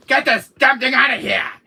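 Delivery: high, loud furious shout. Acted. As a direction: bellow the order. Shouting to remove clown.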